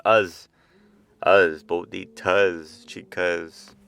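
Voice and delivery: slow, stretched rhyme endings; stressing the rhyme. Shown as a demonstration. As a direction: draw out the us endings, bonitas slow. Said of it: "it's got that rhyme".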